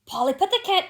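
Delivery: high squawking bird, cut short; raven voice. A narrator's reading. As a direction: croak it like a raven. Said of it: "who would cry in a low voice".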